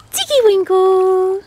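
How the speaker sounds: squeaky high call to the duck. Real, not acted. Owner calling her duckling, very high. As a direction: sing out her name high and drawn out.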